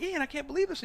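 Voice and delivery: high excited cheer; cheering teammates. Retold or imitated. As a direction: cheer him on from the rail.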